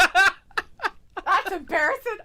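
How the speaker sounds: laughing. Real, not acted. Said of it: "laugh heard".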